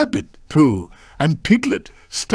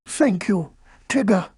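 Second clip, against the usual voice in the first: lightly voiced storybook bear, pitched up; a slightly higher bear voice; Pooh; 6 semitones higher than usual. Bear thanks the tiger for helping him up, lightly voiced character. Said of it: "said Pooh".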